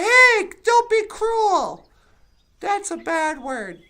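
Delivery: imitating a prim scold correcting a slur; mock scolding. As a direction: ham up a prissy telling-off. Mock offended person scolding.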